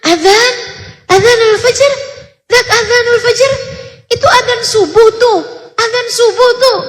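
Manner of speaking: put-on high, loud, shaky old woman calling out about the dawn call; imitating the old wife. Old wife telling her husband it's the dawn call, high and urgent. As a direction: play the frail wife pointing out the adzan.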